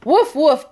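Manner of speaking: playful barking woof; cheerful puppy woof for kids; dog bark. Pretend. Dog barks in picture book.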